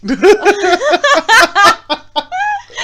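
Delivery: high, loud burst of laughter; howling with laughter. Real, not acted. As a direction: let a big shriek of laughter out. Both laughing at awkward topic.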